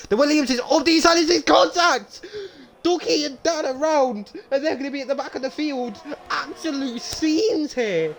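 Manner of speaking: very high, excited crash call; frantic play-by-play breaking into laughter; excited race commentary. Pretend. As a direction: yell the pile-up like a race commentator.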